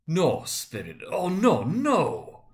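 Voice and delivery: rising to a loud, high anguished cry. Acted. Scrooge sees his own grave.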